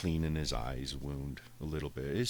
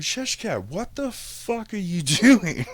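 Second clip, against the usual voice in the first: much higher, louder, slow yell breaking into laughter; laughing exasperated yell; 11 dB louder, slower than usual. Retold or imitated. Owner watching cat poop on carpet, laughing outraged yell. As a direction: scream at the cat while cracking up.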